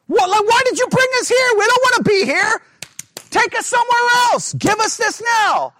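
put-on high, loud bratty child whine; mock the kids nagging from the back seat; whining kids. Kids in the car whining at their parent. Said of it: "and they're like".

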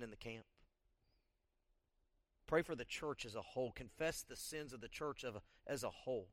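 soft, subdued prayer-leading voice; guided congregational prayer. Acted. Pastor guiding the church to confess sins, soft prayer leading. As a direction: quietly lead them in prayer, with long pauses.

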